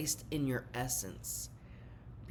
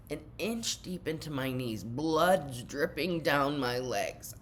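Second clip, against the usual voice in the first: usual speaking voice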